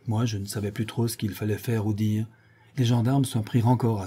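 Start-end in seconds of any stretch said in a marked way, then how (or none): none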